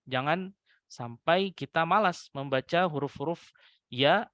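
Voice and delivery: ordinary delivery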